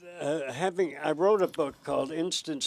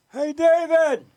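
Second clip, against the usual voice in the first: loud, high call up to the ledge; 12 semitones higher, 10 dB louder, slower than usual. Retold or imitated. Host calling to the man on the ledge, loud cheerful shout.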